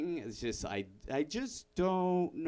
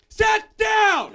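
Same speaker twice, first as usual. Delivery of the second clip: bellowed, much higher and louder; angry shout; 13 dB louder than usual. Acted. Dad yelling at his son to sit down. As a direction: roar it like an enraged father. Parent yelling back at kid.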